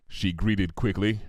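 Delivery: ordinary delivery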